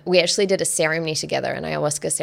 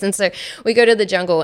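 ordinary talk

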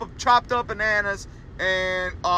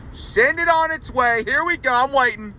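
playful demand; 7 semitones higher than usual. Real, not acted. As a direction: tease them to pay up, pitch way up.

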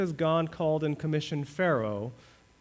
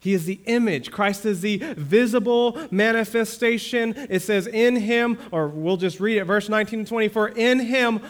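raised, higher, louder scripture proclamation; fervent preaching; 7 semitones higher, 6 dB louder than usual. Acted. Pastor proclaiming scripture to the congregation, raised and fervent. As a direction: proclaim it to the whole church.

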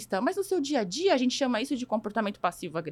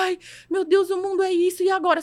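high, loud cry of alarm; hammed-up dismay, pitched up; mock panic; 7 semitones higher, 6 dB louder than usual. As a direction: act out a helpless teen in over their head. Acts out a teen facing the world.

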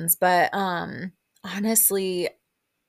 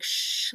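unvoiced-breath "shh", imitating a shush. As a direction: shush someone at the table. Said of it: "like, shushing Sonja".

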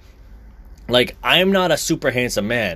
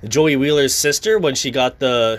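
ordinary talk

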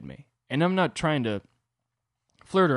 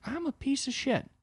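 much higher put-on whiny voice; mock self-loathing; 13 semitones higher, 4 dB quieter than usual. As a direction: whine it in mock despair. Self-loathing reply to girlfriend.

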